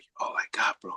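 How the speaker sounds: near-whispered imitation of a crying plea; hushed, tearful retelling; retold tearful plea. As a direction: softly mimic someone pleading through tears. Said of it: "he starts crying".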